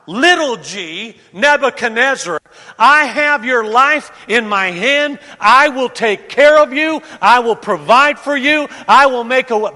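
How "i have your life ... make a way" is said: loud, swaggering promises of a self-made god; proud king's hollow promises, voice raised; boastful king. Acted. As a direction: sell it like a tyrant who thinks he's god. Voicing Nebuchadnezzar's false promises.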